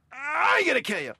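high, grunted threat; effort grunt. Retold or imitated. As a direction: grunt the words out like a serve. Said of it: "if it sounds like I'm saying".

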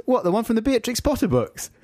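high, incredulous mock question; put-on high voice of confusion; puzzled girlfriend's question. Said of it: "And she goes".

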